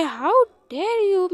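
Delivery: lightly acted outrage, pitch leaping up; mock-offended royal mother; indignant. Queen fuming inwardly at talk about her daughter, indignant.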